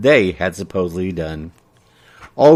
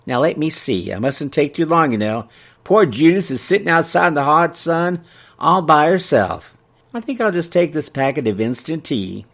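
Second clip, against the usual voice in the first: lightly voiced chatty townswoman, pitched up; fussing customer; 6 semitones higher than usual. A narrator's reading. Customer picks tea while friend waits outside, fussing. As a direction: fuss aloud while choosing.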